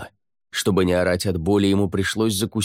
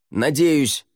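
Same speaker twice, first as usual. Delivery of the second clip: high call back; shouted answer; 7 semitones higher, 4 dB louder than usual. A narrator's reading. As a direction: holler back down, raised pitch. Shouted answer over the cliff.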